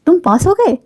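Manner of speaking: amazed that they passed, تم پاس ہو گئے; surprised reading. Shown as a demonstration. Teacher showing تم پاس ہو گئے as an exclamation of surprise. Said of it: "کوئی کہے گا،".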